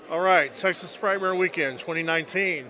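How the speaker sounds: ordinary talk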